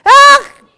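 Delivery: very loud, high mock scream; sudden short yell, played as a bad example; 임재현. Shown as a demonstration. Preacher shows children the noise not to make, mock scream. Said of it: "임재현처럼 "으악!" 이런 소리 말고".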